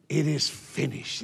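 low, soft pronouncement; subdued and solemn; quiet solemn quote. Acted. First, quiet take of Jesus' words.

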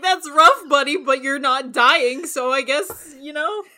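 mock bro comfort, laughing; amused shrugging reassurance. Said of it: "It's like".

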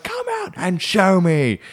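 high, slow, drawn-out demand; mock mum refusing to budge; imitated insistent mum. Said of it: "No, she's like".

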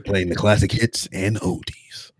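slow smooth radio host. Pretend. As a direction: croon it like a late night DJ. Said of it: "sweet radio voice".

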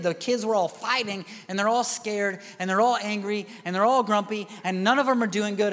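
ordinary delivery

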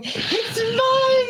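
high, shrill-free Gollum-like cry of mine; possessive whining. Retold or imitated. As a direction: whine it like a creature clutching treasure. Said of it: "he should be in The Lord of the Rings going".

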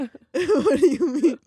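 mock innocent.

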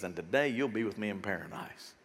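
low, soft promise; quiet assurance. Acted. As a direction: drop right down and promise it gently. Voices Jesus's promise to thief.

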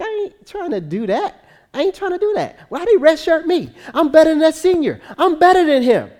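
protesting in a high, aggrieved voice; indignant protest. Acted. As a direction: play an outraged young athlete, building to loud boasts. Acted protest at being redshirted.

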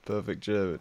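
ordinary talk

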